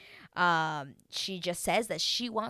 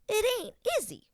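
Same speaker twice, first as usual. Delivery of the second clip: sassy repeat; 4 dB louder, faster than usual. Real, not acted. Repeats the aside with sass.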